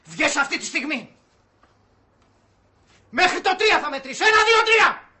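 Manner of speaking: high, loud ordering shout; angry ultimatum. Acted. Man ordering someone out by count of three, furious shout. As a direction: build from a sharp order to a yelled count.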